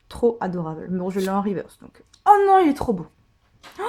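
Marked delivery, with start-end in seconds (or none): 2.3-3.8 high, loud burst of delight; real squeal-like delighted exclamation; delighted gushing. Real, not acted. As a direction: gush over it, high and loud. Delighted at card just drawn.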